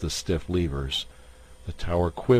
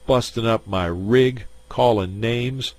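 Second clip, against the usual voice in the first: louder, higher indignant complaint; 5 semitones higher, 5 dB louder than usual. Acted. Kippy complaining to the crowd, indignant and loud. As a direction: play to the crowd, wronged. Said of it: "he said indignantly".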